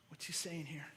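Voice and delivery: hushed whispered question; soft aside to the room. Real, not acted. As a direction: lean in and whisper it. Rhetorical aside to congregation.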